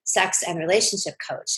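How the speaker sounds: ordinary talk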